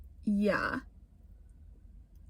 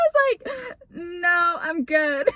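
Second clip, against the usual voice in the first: higher voice, breaking up with laughter; laughing; 11 semitones higher, slower than usual. Real, not acted. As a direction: talk through real giggles as you retell. Laughing while retelling embarrassing moment.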